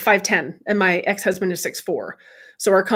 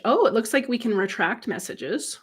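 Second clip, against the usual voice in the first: ordinary talk, nothing marked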